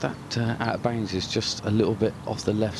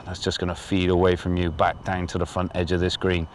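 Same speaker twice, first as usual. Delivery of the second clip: normal talking voice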